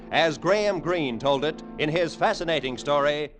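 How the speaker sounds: ordinary talk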